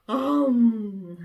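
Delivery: long drawn-out "aum" of a big mouth; imitated gulp. Big fish swallowing Jonah, drawn-out gulping noise. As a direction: swallow someone whole with a slow "aum".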